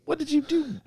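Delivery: mock outrage. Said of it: "I'm like".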